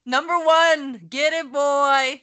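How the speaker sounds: slow, drawn-out hype cheer. Real, not acted. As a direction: cheer him on like a fan. Cheering for artist [name].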